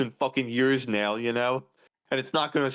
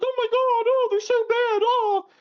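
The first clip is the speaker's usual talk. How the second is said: shrill-free but much higher, louder hysterical wail; mock hysterical shriek; 4 dB louder than usual. Mocking the hysterical crowd with a high wailing voice. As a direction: ham up a panicking sheep. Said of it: "using this hysterical whatever shit".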